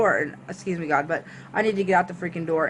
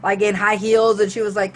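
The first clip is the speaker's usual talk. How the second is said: plain reading voice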